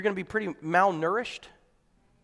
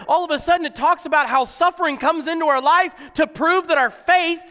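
louder, higher, driving sermon voice; full-force preaching; 7 semitones higher, 4 dB louder than usual. Acted. Pastor presses the congregation on growing faith, full preaching. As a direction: preach it hard and high.

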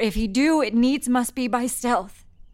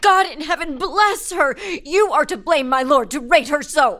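louder, pleading rebuke; protesting nurse; 3 semitones higher, 5 dB louder than usual. Acted. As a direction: stand up to the lord. Nurse defends the girl.

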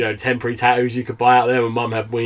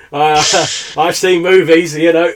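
laughing through the words, pitched up and louder; half-laughing nervous confession; 6 semitones higher, 7 dB louder, much slower than usual. Real, not acted. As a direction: giggle it out, embarrassed at being scared. Laughing at own fear of Ouija.

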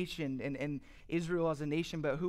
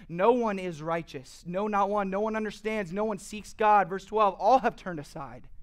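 loud, raised, emphatic Bible reading; forceful scripture preaching; 3 semitones higher, 6 dB louder than usual. Real, not acted. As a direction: drive each verse home to the congregation. Declaims scripture in sermon.

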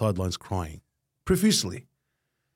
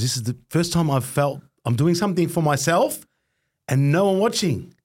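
higher, louder building quote; mild emotional quote; 7 semitones higher, 4 dB louder than usual. Retold or imitated. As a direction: let her relief rise. Retold emotional confession of influencer.

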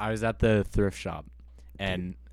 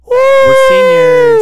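excited shout; 15 dB louder, much slower than usual. Real, not acted. Celebrating being seniors.

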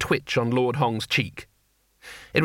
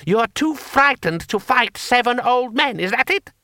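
high, loud, contemptuous snarl; 12 semitones higher, 5 dB louder than usual. Acted. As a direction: spit the taunt at them. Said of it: "Lord Hong snarled".

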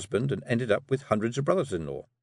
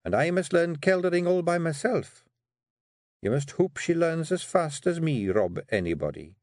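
higher, measured wife's voice; warning Scottish Kelda; 7 semitones higher than usual. Acted. Wife hopes Rob's girl learns fast, warning. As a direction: finish with a pointed warning. Jeanie warns her husband.

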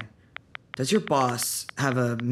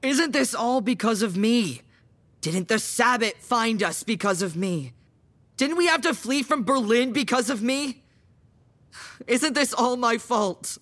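high-pitched, anguished pleading questions; distraught self-blame; 9 semitones higher than usual. Acted. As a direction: pour out the guilt, pitched way up. Guilt-ridden outburst, blaming himself.

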